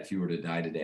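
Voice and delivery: understated, even stress through "die"; no emphasis on die. Shown as a demonstration. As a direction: let "die" pass without any extra weight. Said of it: "I say it just like that".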